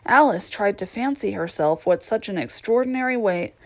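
plain reading voice

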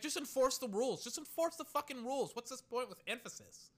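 high-pitched, exasperated outburst; exasperated rant. Real, not acted. Streamer ranting at the league's officiating, exasperated.

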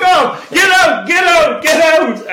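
screaming at full force, high and loud; a teenager screaming at his mother to get out; furious yell. Retold or imitated. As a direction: throw her out of the room with a scream. Retold yelling at his mother.

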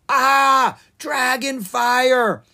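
high, loud cry, dragon fire stretched slow; panicked shout. Acted. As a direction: scream in terror at the flames. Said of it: "he shouted".

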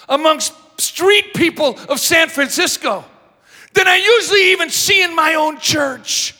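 loud, high preacher's shout; thundering pulpit rebuke; fiery preaching. Acted. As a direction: rebuke the congregation at full force. Preaching at full force.

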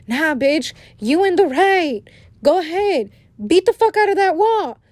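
high-pitched little devil on the shoulder; egging-on inner devil. Acted. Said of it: "little angel and the little devil".

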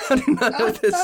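high retold question breaking into laughter; laughing incredulity. Son retells asking confused dad, laughing incredulously. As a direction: crack up while retelling your exasperation. Retort told while cracking up.

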